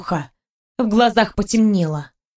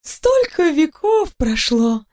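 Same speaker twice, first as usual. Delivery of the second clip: ундина; 3 semitones higher, slower than usual. A narrator's reading. Ундина жалуется на скуку.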